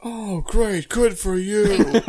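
high, slow sarcastic drawl. Acted. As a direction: drag it out mockingly. Sarcastic reply to Birdman.

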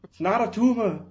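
milk the Arnold line; Arnold Schwarzenegger. Retold or imitated. Host keeps milking Arnold, Austrian accent. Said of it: "Arnold Schwarzenegger".

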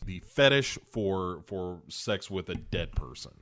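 normal talking voice

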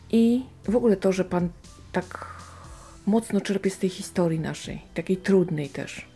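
normal talking voice

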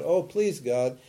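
ordinary delivery

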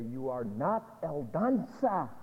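slow, soft and loving; tender divine voice. Acted. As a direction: speak to a beloved child. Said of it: "For he's here to say".

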